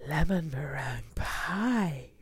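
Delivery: slow, drawn-out, swooping exclamation; delighted exclamation. A narrator's reading. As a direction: savour every word in amazed delight. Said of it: "she exclaimed".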